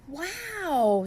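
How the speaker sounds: wow. Acted. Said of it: "said Flip Flop".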